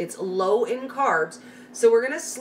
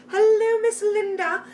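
bright sing-song hello, pitched way up; sing-song greeting; 13 semitones higher, 4 dB louder than usual. Real, not acted. Host warmly sings hello to a viewer in the chat, pitched high.

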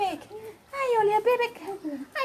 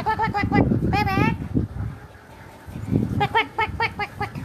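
playful kuak kuak duck sounds; mock duck quacks to a child; duck quacking; much faster than usual.

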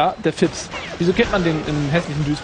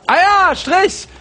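high, loud gleeful announcement; sudden high-pitched cry of triumph; excited call-out; 13 semitones higher, 5 dB louder, slower than usual. Real, not acted. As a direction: pounce on it loudly. Gleefully calls out a penalty mark.

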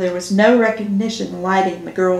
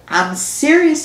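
mock-mature, raised pitch; grown-up voice; 7 semitones higher, 3 dB louder than usual. Georgia to teasing Ginger, mock grown-up voice. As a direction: put on a grown-up, firm tone. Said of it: "in my best grown-up voice".

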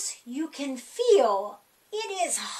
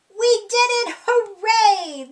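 higher and louder, a big happy cheer; excited cheer; 6 semitones higher, 8 dB louder than usual. A narrator's reading. The cat cheering for the reader after naming all the planets, excited. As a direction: celebrate like you just won.

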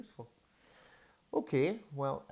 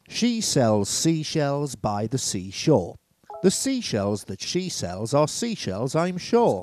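slow and much louder, hitting every s; loud test read; 4 semitones higher, 14 dB louder, slower than usual. Shown as a demonstration. Testing the mic without the de-esser, loud and slow. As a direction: belt the tongue twister out for the mic. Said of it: "listen to the undiest one first".

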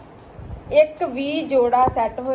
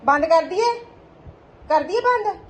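playful sing-song tease, pitched much higher; teasing question; 7 semitones higher, 4 dB louder than usual. Acted. Mother teasing her toddler about shutting the door, playful high sing-song.

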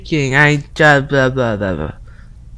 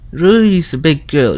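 ordinary delivery

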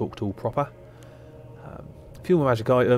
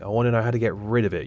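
usual speaking voice

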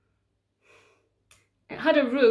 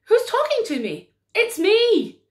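startled Mary; 11 semitones higher, 7 dB louder than usual. Acted. Mary asks the chair.

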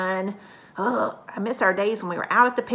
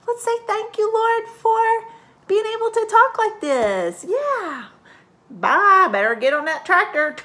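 much higher, louder folksy farmer voice; a cheerful farmer heading back to his tractor; high country puppet voice; Farmer Brown puppet; 12 semitones higher, 6 dB louder than usual. Pretend. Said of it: "Farmer Brown".

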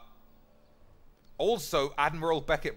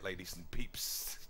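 whispering; 6 semitones lower, 7 dB quieter, slower than usual. Real, not acted. Whispered aside.

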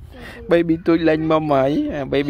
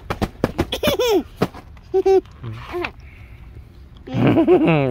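high laugh. Real, not acted. Mother laughs at child.

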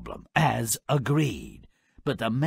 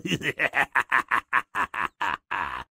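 smug chuckle; 4 semitones lower than usual. Real, not acted. Laughs at the happy ending.

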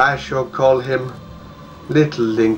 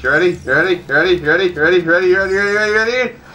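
climbing higher with each you ready; building hype; 7 semitones higher, 3 dB louder, much faster than usual. Real, not acted. Streamer hyping his viewers before the magic, rising excitement. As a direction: pump up the tension before the trick.

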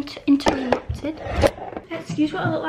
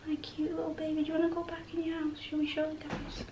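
whisper to pet; 10 dB quieter, faster than usual. Real, not acted. Whispering baby talk to her hamster in her hand. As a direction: coo it under your breath.

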